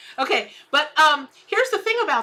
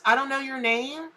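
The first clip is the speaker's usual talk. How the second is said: ordinary talk, nothing marked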